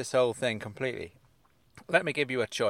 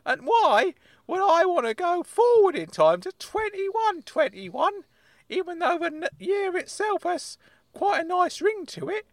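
high-pitched indignant uncle character; squeaky-high, affronted comic voice; Uncle Claude; 5 dB louder than usual. Acted. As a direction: play a worked-up uncle way up high.

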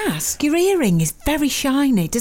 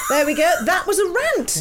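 normal talking voice

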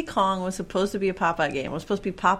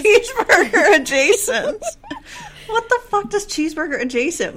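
incredulous laughing squeal, much higher and louder; 14 semitones higher, 8 dB louder than usual. Real, not acted. Co-host repeating the odd phrase, squealing with laughter. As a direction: crack up in disbelief. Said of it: "laughing disbelief".

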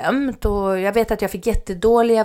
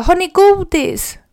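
high, loud, slow plea; loud pleading question; urgent call; 11 semitones higher, 7 dB louder, slower than usual. Retold or imitated. Calling to stopped cyclists.

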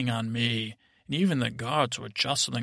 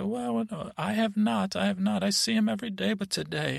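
higher-pitched voice piping up from the crowd; woman in the back; 8 semitones higher than usual. Retold or imitated. A villager answers about the shepherd boy from the back, higher-pitched character voice. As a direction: put on a high voice for a villager. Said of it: "someone in the back said".

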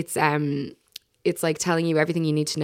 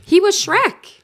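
mock indignant; 4 semitones higher, 5 dB louder, much slower than usual. Teasing disbelief exchange.

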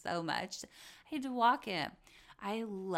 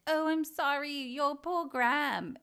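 high, louder mock sympathy; 6 semitones higher, 4 dB louder than usual. Podcaster mimics Lisa's fake sympathy, high and loud. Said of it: "and she's like".

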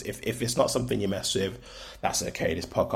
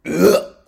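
loud, higher-pitched mock-disgusted "ugh"; disgusted groan; 10 semitones higher, 14 dB louder than usual. Podcaster imagining hearing their own voice back, disgusted groan. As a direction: groan in playful revulsion at your own voice. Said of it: "listen back to this and feel like".

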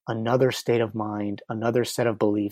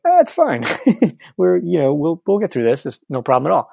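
high, laughing, falsely cheery "it's fine"; mock breezy brush-off; 6 semitones higher, 5 dB louder than usual. To her, laughing off the shame in a high breezy voice. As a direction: brush it off with a nervous laugh, pitched way up.

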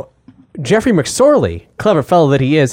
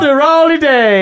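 hammy olde English greeting; lightly hammed faux Shakespearean; olde English accent; 8 semitones higher, 3 dB louder than usual. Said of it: "stuck in this accent".

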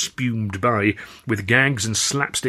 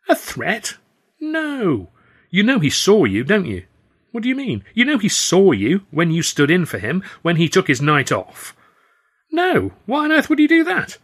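high, disbelieving Stan; Stan incredulous; 9 semitones higher, 4 dB louder than usual. Acted. Stan refusing to believe Charlie plotted against them, high. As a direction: pitch it up in disbelief.

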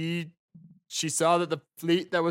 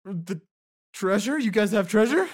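mock surprise; 6 semitones higher, 5 dB louder than usual. Prisoner feigns surprise.

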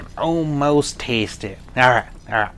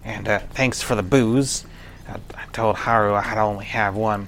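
normal talking voice

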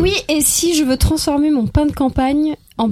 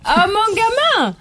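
higher, slow, loud Provençal fatherly cry; hammed-up old Provence dad; Marcel Pagnol impression; 7 semitones higher, 4 dB louder, slower than usual. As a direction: stretch it like an old film father greeting his kid. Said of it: "Ça, c'est Marcel Pagnol".

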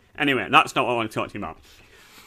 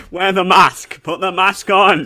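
loud, higher mock order; mock command; 5 semitones higher, 7 dB louder, slower than usual. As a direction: call it out slowly like a playful demand. Mock command while urging accent.

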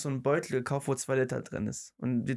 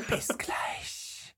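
whispering; 4 dB quieter than usual. Real, not acted. Whispered goodbye before the break.

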